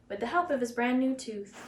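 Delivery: plain reading voice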